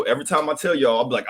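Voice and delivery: ordinary talk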